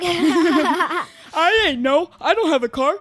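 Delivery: laughing through a copied duck voice; giggling copy of the duck impression; Donald Duck voice. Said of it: "[name]'s doing his Donald Duck impression".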